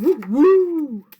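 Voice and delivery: loud, high barking "Woo, woo."; dog bark. Acted. The dog yells at the sneezing flea, loud bark.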